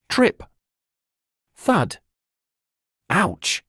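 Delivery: sharp high yelp of pain; cry of pain. Acted. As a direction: yelp it out as you hit the ground. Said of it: "Courage exclaimed in pain".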